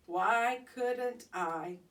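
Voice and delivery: plain reading voice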